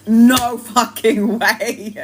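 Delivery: very slow, stretched no fucking way; drawn-out disbelief. Retold or imitated. Told her family smelled it, slow stunned denial. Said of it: "I was like".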